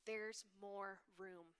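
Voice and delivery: slow, softened delivery; half-speed, softly spoken announcement; simple quoted message. A narrator's reading. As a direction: let the words out slowly and softly.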